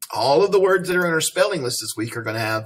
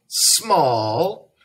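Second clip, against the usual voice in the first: slow hint. Real, not acted. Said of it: "teacher hinting to class".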